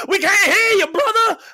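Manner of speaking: high, hollered heckle from the host; imitating screaming host. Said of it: "and the fucking host is".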